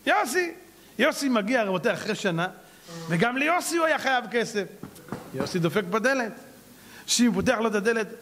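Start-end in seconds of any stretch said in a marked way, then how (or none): none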